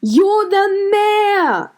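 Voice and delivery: loud, high, drawn-out shout; crowd shouting. A narrator's reading. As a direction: shout it at the mayor together. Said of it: "the crowd shouted".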